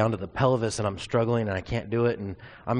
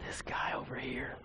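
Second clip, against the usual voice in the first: whispered aside; 11 dB quieter than usual. Retold or imitated. Dad mocking son to friend.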